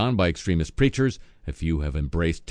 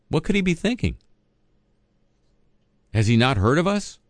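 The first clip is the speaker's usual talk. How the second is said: mock disbelief; 8 semitones higher than usual. Host mocks Howard's greatest nation claim.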